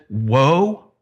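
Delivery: weighty, understated prophet's woe; prophetic pronouncement. Retold or imitated. Preacher quoting an Old Testament prophet, understated solemn pronouncement. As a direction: pronounce it as a judgment, with restraint. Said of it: "he's pronouncing destruction and judgment".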